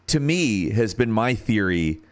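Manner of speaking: normal talking voice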